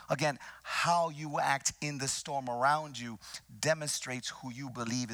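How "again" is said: ordinary delivery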